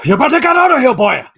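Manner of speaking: high, loud mock-Welsh shout; bad Welsh accent. Pub brawler ordering a stranger out, shouted bad Welsh accent. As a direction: bellow it in a hammed-up Welsh accent. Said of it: "accent you're trying to pull off".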